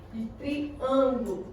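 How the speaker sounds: slow "triângulo" with weight on "ân"; stress on middle syllable. Shown as a demonstration. Teacher points out the tonic syllable, slowly stressing "ân" in "triângulo". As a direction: stretch the "ân" in "triângulo". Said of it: "foi a que eu tive mais intensidade".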